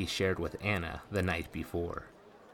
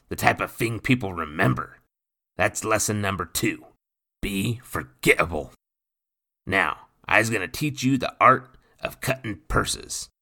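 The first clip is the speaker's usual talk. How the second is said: lightly voiced gruff pirate, a touch louder; rough pirate voice; 3 semitones higher, 7 dB louder than usual. A narrator's reading. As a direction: speak like a swaggering old sea rogue. Nathan teaches.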